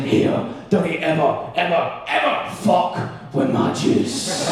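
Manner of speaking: slow, menacing Scottish threat; scary Scottish accent. Acted. Hungover girlfriend warning her boyfriend, slow scary Scottish accent. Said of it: "scariest words I've ever heard in a Scottish accent".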